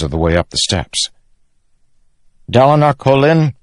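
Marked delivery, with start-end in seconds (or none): 2.5-3.5 high, loud formal address; ringing royal proclamation. A narrator's reading. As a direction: proclaim the name to the chamber. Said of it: "said in a loud voice".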